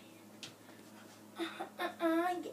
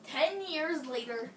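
normal talking voice

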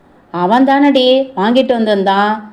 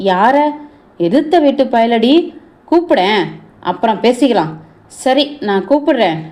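ordinary delivery

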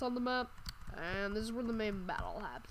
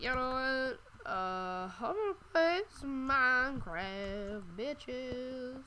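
excited; 5 semitones higher, 4 dB louder, slower than usual. Retold or imitated. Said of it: "I'm like".

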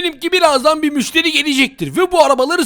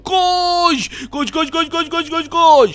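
urging run; 5 semitones higher, much slower than usual. Acted. Urges himself to run.

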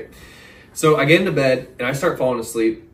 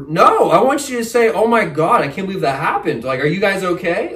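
higher and louder, mock-pleading for empathy; exasperated rant; 8 semitones higher, 7 dB louder than usual. To the indifferent manager, an exasperated rant. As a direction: demand the sympathy he never got.

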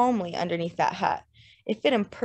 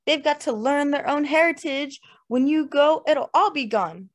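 raised, eager pitch with a tipsy push; drunken enthusiasm; 7 semitones higher, 4 dB louder than usual. A narrator's reading. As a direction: urge them on excitedly. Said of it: "with a quick burst of drunken enthusiasm".

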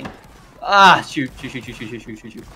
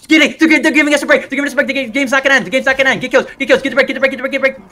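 very high, loud, urgent calls; frantic yelling; 11 semitones higher, 5 dB louder than usual. Real, not acted. Gamer urging team before the game ends, frantic shout. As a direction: shout repeated orders in a real frenzy. Frantic orders as match nears end.

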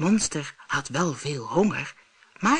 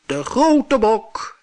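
heavy-voiced goat; 6 semitones higher, 6 dB louder, much slower than usual. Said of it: "met zware stem".

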